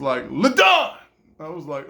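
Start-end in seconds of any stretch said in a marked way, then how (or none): 0.3-1.0 loud, high name call across the cell. Retold or imitated. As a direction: bark the name like a guard. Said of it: "he was calling names".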